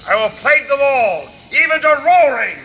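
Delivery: loud raging vow; furious shout. Acted. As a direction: roar it out in anger. Prospero vows revenge loudly.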